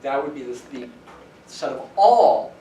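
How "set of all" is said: all drawn out slowly and loud; slow, heavy emphasis on all; stress on ALL. Shown as a demonstration. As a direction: lean hard on all, then let it fall. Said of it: "emphasis on the word all".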